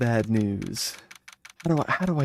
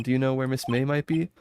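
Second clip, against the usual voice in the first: ordinary talk, nothing marked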